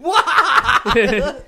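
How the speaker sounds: high laughing what, loud. Real, not acted. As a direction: laugh through the word, high-pitched. Reacting to friend's laugh.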